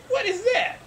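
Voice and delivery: very high, loud alarm; startled man. Acted. Man spotting a strange outline by the door, startled. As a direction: shoot way up in startled disbelief. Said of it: "And he's like".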